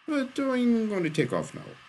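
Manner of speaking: very high-pitched, uneasy voice; nervous exit. A narrator's reading. Arsonist excuses himself to Shaw, nervous and high. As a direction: squeak out an excuse to flee.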